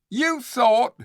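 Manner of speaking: high, loud mocking echo; scornful repeat. The ape mocking the bear, scornful echo. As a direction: throw his words back at him. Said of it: "repeated the ape".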